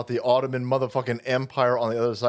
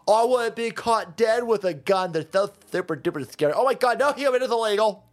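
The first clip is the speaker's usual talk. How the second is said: higher, faster sing-song parody voice; mock whiny liberal; 6 semitones higher than usual. Streamer mocking dumb libtards, in a higher, whiny parody voice. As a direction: ham up a pearl-clutching liberal.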